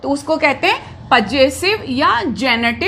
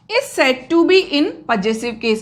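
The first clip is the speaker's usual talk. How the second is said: dictating slowly; much slower than usual. Shown as a demonstration. Teacher dictating a definition.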